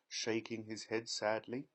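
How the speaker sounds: ordinary delivery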